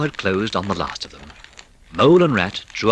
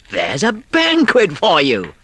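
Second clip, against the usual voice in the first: slow, louder showman's announcement; proud Rat; 7 semitones higher, 6 dB louder, slower than usual. A narrator's reading. Rat showing Mole the table, slow and proud. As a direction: present the feast with a flourish. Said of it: "observed the Rat".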